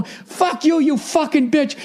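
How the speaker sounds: imitated high-pitched cursing; mock yelling. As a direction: hurl abuse in a high mock yell. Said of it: "yelling at us".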